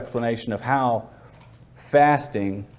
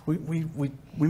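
soft, reluctant stammer; acted agonizing; 2 semitones higher, 8 dB quieter than usual. Sinner dreads telling his brothers, mock agonized soft stammer. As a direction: dread the confession, faltering softly. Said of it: "It'll take days of agonizing".

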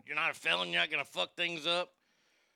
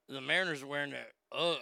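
usual speaking voice

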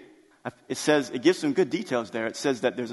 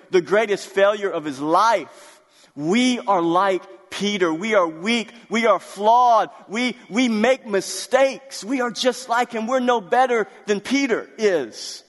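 higher, louder, slow preaching; preaching at full force; 7 semitones higher, 5 dB louder than usual. Acted. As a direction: drive it home to the church, slowly. Preaches to the congregation.

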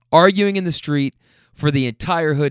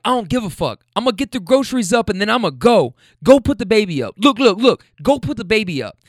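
raised, pitched-up retort as the man; imitated arguing; 8 semitones higher, 4 dB louder than usual. Man telling her to put the baby up, imitated heated arguing. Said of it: "now they're screaming".

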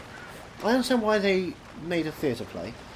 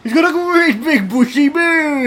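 gleeful cry; 10 semitones higher, 9 dB louder, slower than usual. Pretend. Gleeful joke about beard.

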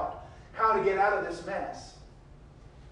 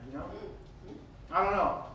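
usual speaking voice

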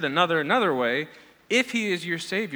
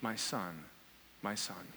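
very low, hushed lament; grieving father; 9 semitones lower, 13 dB quieter than usual. Retold or imitated. Preacher repeats David's cry for Absalom, hushed grief. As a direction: sink into quiet, retold mourning. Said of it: "David is crying over his son".

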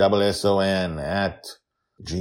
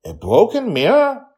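put-on high, loud surprised girl; shocked little girl, pitched way up; Joy hears the news, loud and surprised; 10 dB louder than usual. A narrator's reading.

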